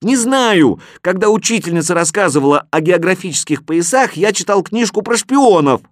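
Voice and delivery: put-on high, loud boyish protest; high-pitched defensive shout; shouted confession. A narrator's reading. Boy shouts his confession back.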